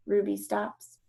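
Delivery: plain reading voice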